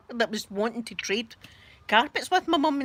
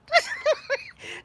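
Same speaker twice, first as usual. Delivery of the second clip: very high giggle; real high-pitched burst of laughing; amused laughter. Real, not acted. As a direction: crack up at your own warning. Laughing at her own warning.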